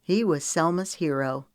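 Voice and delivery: usual speaking voice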